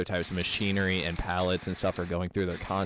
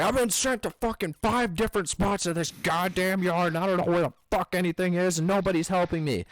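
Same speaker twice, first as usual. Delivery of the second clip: high, loud imitation of an angry old man; imitating angry driver; 9 semitones higher, 3 dB louder than usual. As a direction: mimic a furious customer ranting.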